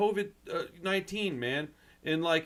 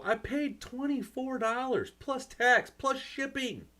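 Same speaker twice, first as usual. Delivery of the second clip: exasperated rant; 7 semitones higher than usual. Retold or imitated. Exasperated at complaining customers.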